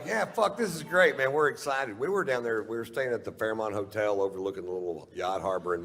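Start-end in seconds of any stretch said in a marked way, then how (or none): 0.0-2.0 high, eager gushing; excited. Retold or imitated. Comic telling his wife about the yacht, excited and high. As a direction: bubble over with glee. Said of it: "So I was like".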